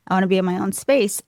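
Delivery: ordinary talk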